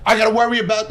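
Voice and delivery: defiant outburst. Real, not acted. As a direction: fiercely refuse to be called a coward. Defiant about his son's death.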